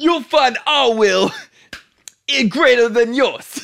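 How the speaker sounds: high, slow, bellowed mock Brooklyn; Brooklyn accent. Friend topping the movie joke, shouted Brooklyn accent. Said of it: "sounds like that in Brooklyn".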